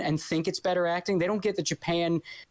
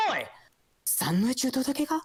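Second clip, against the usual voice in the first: higher, emotional Japanese line with a big "Oi"; hammed-up anime Japanese; 7 semitones higher than usual. Shown as a demonstration. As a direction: throw a dramatic "Oi" like an anime hero. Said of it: "the more emotion in the words".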